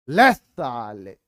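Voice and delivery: slow, low, drawn-out taunt; mock fight taunt. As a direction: stretch it out like a schoolboy picking a fight. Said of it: "ऐसे करते थे". (धीमी, खिंची हुई चिढ़ाने वाली आवाज़)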